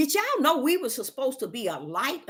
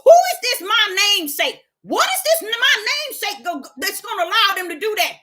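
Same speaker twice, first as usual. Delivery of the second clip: much higher, louder protesting shout; protesting to God, high and shouting; alarmed shout; 8 semitones higher, 4 dB louder than usual. Pretend. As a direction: cry out wait in alarm.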